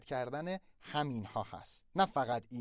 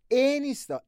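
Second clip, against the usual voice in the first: ordinary delivery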